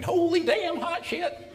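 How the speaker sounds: slow, loud Southern drawl imitation; deep Southern accent. Guy on the videotape seeing craft in the sky, imitated in a deep Southern accent. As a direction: mimic an amazed good old boy with a very deep Southern accent. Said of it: "the guy with the very deep Southern accent is going".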